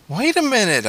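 high, protesting cry. A narrator's reading. Boy being shoved out by stepmother, crying out. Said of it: "I cried".

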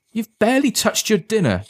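high-pitched motherly protest. A narrator's reading. Mother objects as son leaves dinner, protesting in high pitch. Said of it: "his mother protested".

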